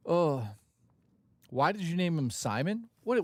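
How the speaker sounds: ordinary talk, nothing marked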